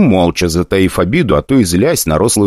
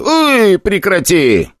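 yelp; 13 semitones higher, much slower than usual. Acted. Slapped dwarf.

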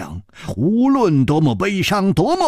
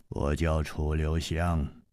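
low, slow, steady hero's voice; calm older hero; 12 semitones lower, 10 dB quieter, slower than usual. Acted. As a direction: speak low and unhurried, gently reproaching. Chu Liuxiang names himself.

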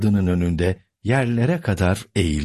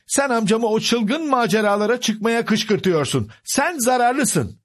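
high-pitched indignant niece; accusing; 14 semitones higher than usual. Acted. Niece blaming Sancho for her uncle's adventures, accusing. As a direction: accuse him high, slowing on the last words.

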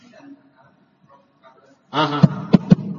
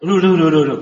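loud repeated lu lu lu; drilled syllable; 2 semitones lower, 6 dB louder, faster than usual. Shown as a demonstration.

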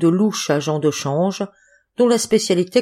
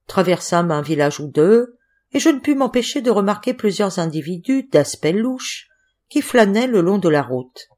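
usual speaking voice

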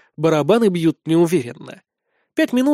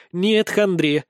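ordinary delivery

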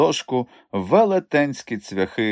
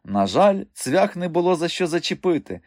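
usual speaking voice

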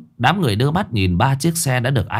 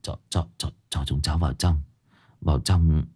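much softer, lower, stuttering plea; stammering; 8 semitones lower, 7 dB quieter than usual. A narrator's reading. Dương stammers begging the villagers to let them in. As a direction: barely get the words out. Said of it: "Dương lắp bắp".

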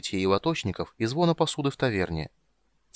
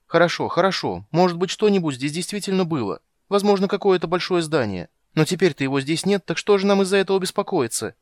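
high, loud anxious chatter; nervous babbling; 8 semitones higher, 5 dB louder than usual. Acted. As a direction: talk fast to dodge the fear. Kender nervously talks it away. (тревожно, сбивчиво)

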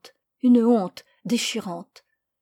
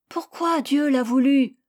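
put-on high, loud pleading little boy; child's voice; 5 semitones higher, 6 dB louder than usual. Boy begging his parents to spare the lamb, high and loud. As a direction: plead like a small boy defending his pet.

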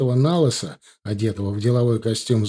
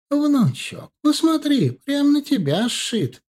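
high, creaky granny coaxing; a creaky old trader talking someone into a sale; creaky old woman voice; slower than usual. Acted. Old vendor coaxes young customer.

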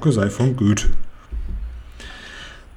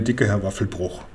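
ordinary delivery, nothing marked

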